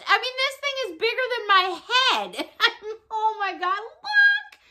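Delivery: high-pitched, laughing gush; giddy amazement. Real, not acted. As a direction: marvel with a giggle, climbing to a squeal. Giggling amazement at the giant item.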